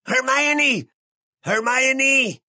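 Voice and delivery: very high screech of a name; acted desperate high cry; frantic screaming. A narrator's reading. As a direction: shriek the name twice in panic. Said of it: "Deja ya de gritar".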